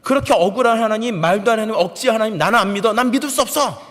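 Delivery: high, heated protest building to loud; indignant senior soldier's mounting tirade; angry outburst. Retold or imitated. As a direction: argue indignantly, rising to a yell. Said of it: "라고 저한테 화를 내더라고요".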